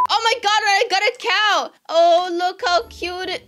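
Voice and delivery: high, loud thrilled squeal; bursting with excitement; excited shriek. Real, not acted. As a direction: shriek with joy at the cow. Finally hatched a cow.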